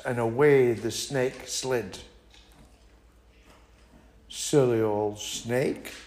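plain reading voice

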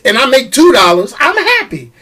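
usual speaking voice

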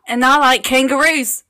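loud, put-on Australian accent; hammed-up Australian voice, loud. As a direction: declare it in a broad Australian accent. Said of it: "just do the accent".

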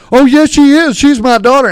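indignant father in a mock shout. Proud father defends his benched daughter, mock shout. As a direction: raise your voice and protest like an offended father. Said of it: "And he goes".

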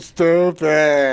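slow dopey voice; dumb voice. Acted. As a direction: play it slow and stupid. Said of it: "have to do the voice".

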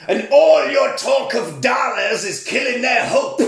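rousing speech. Retold or imitated. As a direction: deliver it like a hammy rallying cry.

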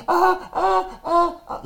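very high, strained "ah" cries of pain; mock pained cries. Boy crying out to his aunt over his shoulder, high pained whimpers. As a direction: whimper high like a hurt kid.

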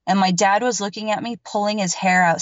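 ordinary delivery

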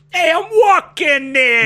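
hammed-up New Yorker yelling at a car; slow, loud, cartoon New York shout; mock New York accent. Co-host hams up a New York accent.

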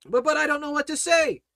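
high, quick, stammering protest; mock fretting. Worried believer protesting to the preacher, mock fretting. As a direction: blurt it like a hammed-up worrier. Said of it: "you're worried and fretting and fearing".

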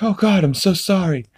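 high put-on voice, sorry and awkward; imitating Peter apologizing. Dad apologizing to a widow on the phone, high and flustered. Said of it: "he's like".

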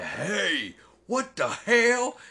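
loud, high outraged T-Rex. Acted. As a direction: bellow it slowly in mock outrage.

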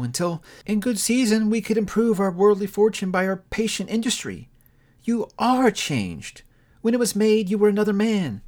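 a hint of a hurt young woman letting go; Belle, lightly voiced. A narrator's reading. Young woman to her changed lover, lightly voiced and sorrowful.

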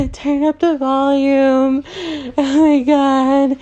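hammed-up screeching high-pitched panic, very high and loud; stupid Cindy impression. Imitating Cindy from Scary Movie in a shrill high panic. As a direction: shriek it like a frantic horror-movie girl. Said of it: "doing a stupid impression of Cindy".

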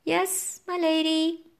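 high little servant voice; panting toad. A narrator's reading. Toad hopping away from the witch, panting. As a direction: squeak out obedience as a toad. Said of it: "hopped away panting".

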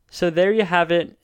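usual speaking voice

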